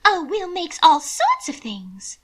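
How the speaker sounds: put-on high, slow, light young lady; a high genteel woman; Mina's voice. A narrator's reading. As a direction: lift into a delicate high lady's voice. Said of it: "Mina said".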